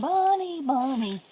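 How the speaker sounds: high, loud call for a cat. Real, not acted. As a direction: sing out the cat's name. Calling for a missing cat.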